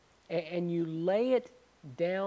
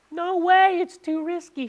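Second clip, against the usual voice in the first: much higher and louder, frantic refusal; shrieking girlfriend; 12 semitones higher, 11 dB louder than usual. Retold or imitated. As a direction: yelp it out like the scared girl. Girl refusing, high and loud.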